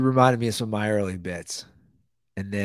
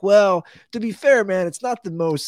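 high mock reply; 12 semitones higher, 8 dB louder than usual. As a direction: shrug it off in a high voice. His own retold reply.

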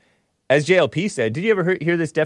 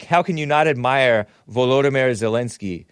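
usual speaking voice

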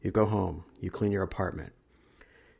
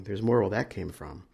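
plain reading voice

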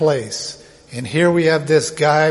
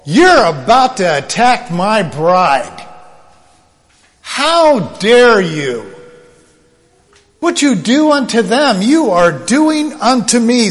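forceful, higher, affronted confrontation; indignant Lord; 5 semitones higher, 4 dB louder than usual. Acted. Jesus to Paul about attacking his bride, indignant. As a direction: confront him as an outraged husband defending his bride. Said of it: "Who is speaking to Paul?".

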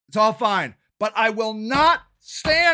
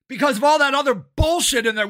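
usual speaking voice

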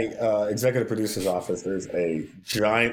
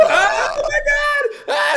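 much higher, shouted, near tears; overwhelmed shout; 6 dB louder, much slower than usual. Retold or imitated. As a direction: cry out in stunned joy. Told [name] is on phone.

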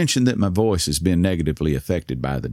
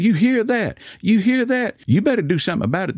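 much higher, indignant mock outcry; offended cashier; 11 semitones higher than usual. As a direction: crow in outrage at the manager, voice raised high. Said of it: "The poor woman was terribly offended".

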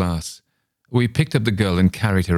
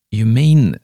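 louder, high, caught-breath realisation; startled gasp; 5 semitones higher, 10 dB louder than usual. A narrator's reading. Narrator realising Mister A was her father, gasping. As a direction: blurt it as the truth dawns. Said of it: "I gasped in spite of myself".

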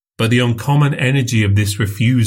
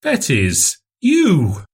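name breathed, then "you!" jumps much higher in shock; startled gasp; 7 semitones higher than usual. A narrator's reading. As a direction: caught off guard, barely getting it out. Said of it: "in a startled whisper".

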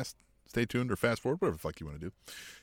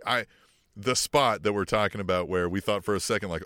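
ordinary talk, nothing marked

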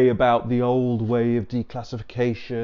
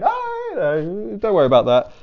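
mock dismissive official; 8 semitones higher, 4 dB louder than usual. Officials waving off questions, in a mock breezy brush-off voice. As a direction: wave it away like a shifty official.

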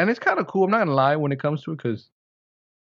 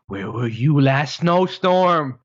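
slow, drawn-out playful complaint; drawn-out comic lament; mock exasperation; 3 semitones higher, 4 dB louder, much slower than usual. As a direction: stretch it out, rising in mock outrage. Mock complaint to found device.

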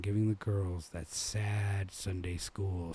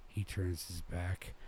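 plain reading voice

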